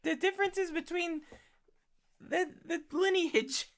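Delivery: high, put-on fan voice; Margaret voice. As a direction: answer as a gushing listener, up high. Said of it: "hey, Margaret, what was your favorite part".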